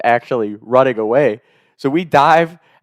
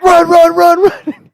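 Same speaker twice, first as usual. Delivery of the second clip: high, loud mock-panicked yell; panicked shout; 10 dB louder, slower than usual. Prankster fleeing as the victim jumps up, panicked shout. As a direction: urge an accomplice to bolt.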